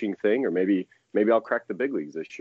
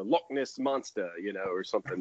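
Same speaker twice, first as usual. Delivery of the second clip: put-on playful, amateur British accent; attempted British accent; 5 dB quieter than usual. Guest joking with British host about being rare, attempted British accent. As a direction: slip into a mock British accent. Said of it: "good attempt at a British accent".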